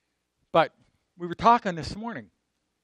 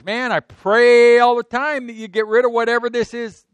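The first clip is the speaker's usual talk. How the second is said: Paul pleading; 7 semitones higher, 8 dB louder than usual. Acted. Voices Paul pleading with God.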